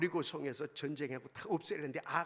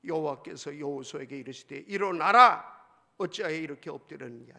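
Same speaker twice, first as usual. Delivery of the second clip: scripture reading, loud command; 3 semitones lower, 7 dB louder than usual. Shown as a demonstration. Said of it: "십 절 시작".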